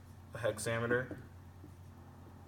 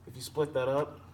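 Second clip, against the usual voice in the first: usual speaking voice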